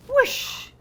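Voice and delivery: high, loud rushing whoosh. Acted. Elephant lifts boy, high loud whoosh. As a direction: swoop it upward.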